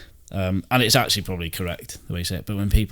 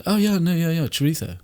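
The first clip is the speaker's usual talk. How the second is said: higher put-on voice, chorizo with a lisp; imitating housemate; 8 semitones higher than usual. Mocking his housemate saying chorizo, higher voice. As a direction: send up the housemate lightly. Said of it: "She says".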